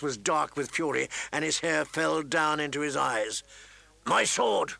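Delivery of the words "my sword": high, urgent shout to a squire; raised-voice angry demand; shouted command. A narrator's reading. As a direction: call out sharply for a weapon. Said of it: "he shouted to his squire".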